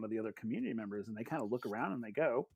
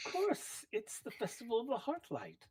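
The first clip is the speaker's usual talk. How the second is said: gracious villager in reply; 10 semitones higher, 4 dB quieter than usual. Acted. Villager answers tearful Varric in character.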